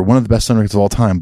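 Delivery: ordinary talk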